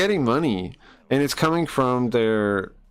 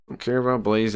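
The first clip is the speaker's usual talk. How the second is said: usual speaking voice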